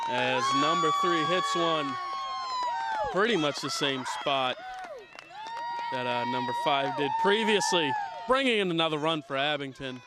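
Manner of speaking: loud, rising play-by-play call; excited call. Real, not acted. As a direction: call the hit with real excitement.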